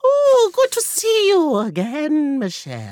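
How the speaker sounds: high, slow squeaky leprechaun croon; creepy leprechaun granny. Pretend. Host mocks friend's grandma as high slow leprechaun. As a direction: greet her like a sinister little leprechaun.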